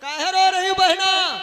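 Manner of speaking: loud concerned sage. Acted. Narad asks a crying lady why she weeps, loud and high. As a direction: call out the question over the crying.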